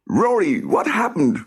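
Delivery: high, anxious question; worried boy. Acted. Sam asking Rory what happened, worried. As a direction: ask urgently.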